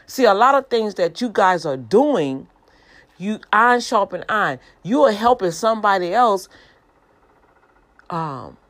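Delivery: exhorting the viewers at full force; preaching. Real, not acted. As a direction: preach it with rising fervor.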